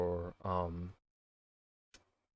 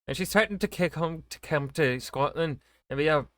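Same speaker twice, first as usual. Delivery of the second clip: put-on higher-pitched, struggling Scottish accent; botched Scottish accent; 6 semitones higher than usual. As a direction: have another go in a bad Scottish accent. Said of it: "how to do a Scottish accent".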